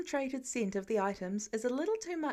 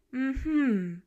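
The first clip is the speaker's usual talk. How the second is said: louder skeptical hum; unconvinced hum; Pepper voiced; 5 dB louder than usual. A narrator's reading. Pepper not buying Tony's excuse, skeptical hum.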